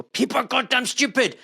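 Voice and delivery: exasperated high-pitched outburst; angry outburst. Retold or imitated. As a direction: snap it out in frustration. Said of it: "I, I get angry".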